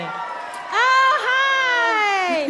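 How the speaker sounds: bursting with hometown pride; enthusiastic. Real, not acted. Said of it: "the most enthusiastic I've ever seen".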